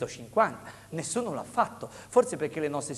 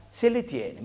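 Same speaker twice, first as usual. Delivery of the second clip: usual speaking voice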